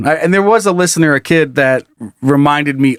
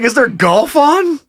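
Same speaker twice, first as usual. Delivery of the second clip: mock confused brother; 11 semitones higher, slower than usual. Brother mocking in group chat.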